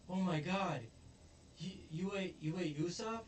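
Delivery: put-on high-pitched horrified kids; mock shock. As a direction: imitate kids gasping out their disbelief. Kids horrified friend was eaten.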